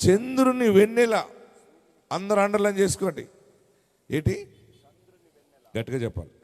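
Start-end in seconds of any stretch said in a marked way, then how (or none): none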